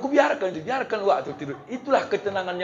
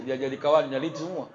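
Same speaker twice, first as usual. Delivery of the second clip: ordinary delivery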